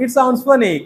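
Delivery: with a smile. Shown as a demonstration. Teacher shows students the smiling way to react to a joke. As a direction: let a smile carry the line. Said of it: "smile के साथ".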